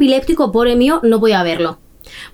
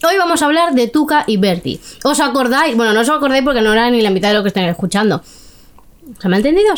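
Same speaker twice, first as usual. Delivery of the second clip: ordinary talk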